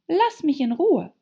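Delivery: high, loud, indignant outburst; indignant cry. A narrator's reading. Alice telling the pigeon off, indignant. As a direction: snap it out, offended. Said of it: "rief Alice empört".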